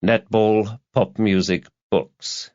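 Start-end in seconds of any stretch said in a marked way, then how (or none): none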